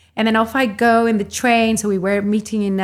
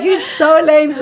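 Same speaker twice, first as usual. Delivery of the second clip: laughing scornful verdict, a bit louder; mock him while laughing; 6 semitones higher, 5 dB louder than usual. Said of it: "I was like".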